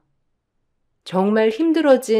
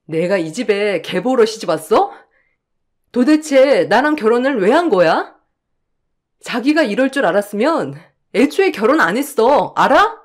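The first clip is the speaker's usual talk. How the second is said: angry outburst. Acted. Wife yelling at husband over the marriage, growing angry. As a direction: let it boil over at the end.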